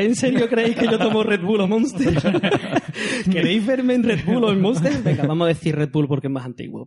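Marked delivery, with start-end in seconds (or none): 0.0-4.9 high, laughing disbelief. Real, not acted. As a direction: laugh through the question. Laughs at the energy-drink question.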